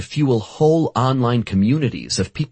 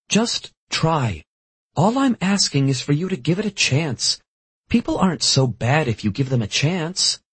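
gentle pleading; 7 semitones higher than usual. A narrator's reading. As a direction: coax him gently, voiced higher. Rachel sighs, pleads.

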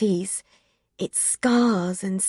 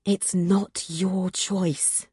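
slow tender; 3 semitones lower, 5 dB quieter, slower than usual. Acted. Will gently insists.

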